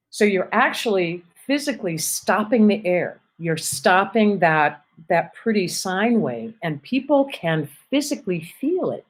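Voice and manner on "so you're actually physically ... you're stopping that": usual speaking voice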